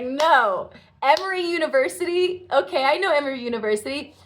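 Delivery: retold astonishment, pitched high; amazed disbelief. Student hearing the guru's answer, astonished. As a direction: blurt it out high, as if stunned by the answer.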